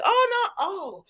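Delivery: a sudden high squeak of alarm; mock startled gasp. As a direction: jump right up in pitch on oh. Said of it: "Sheila is like".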